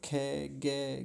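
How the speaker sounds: put-on खे, गे drawn out slowly as children are taught; childhood-style खे, गे. Shown as a demonstration. Teacher recalling how children learn letters, chanting slowly. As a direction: imitate the rote childhood chant खे, गे. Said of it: "ऐसा बोलना सिखाया गया".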